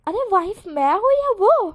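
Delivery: slow, loud, high exclamation; flustered outburst. Acted. Blushing husband blurting out to himself, loud and high. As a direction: blurt it out in embarrassment.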